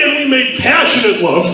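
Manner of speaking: ordinary talk, nothing marked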